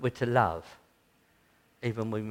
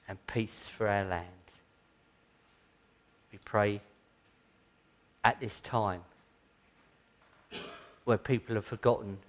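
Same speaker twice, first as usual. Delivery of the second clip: closing prayer; 2 semitones lower, 4 dB louder than usual. Acted. Preacher leading the congregation in Advent prayer, solemn and earnest. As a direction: pray over the congregation with reverence.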